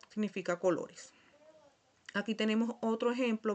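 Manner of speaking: ordinary talk, nothing marked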